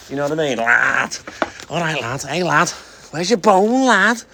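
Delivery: mock Scouse lad talk; broad Scouse accent. Shown as a demonstration. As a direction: lay the broad Scouse on thick. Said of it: "not like a dead broad accent".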